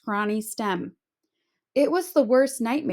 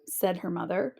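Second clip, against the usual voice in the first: plain reading voice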